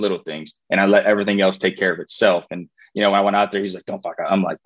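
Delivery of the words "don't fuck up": softer, lower mock coach warning; a hint of a coach's hushed warning; retold quiet warning. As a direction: murmur it low like a coach behind you. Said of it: "he just whispers underneath his breath".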